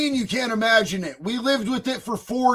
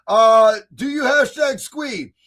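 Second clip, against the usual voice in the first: usual speaking voice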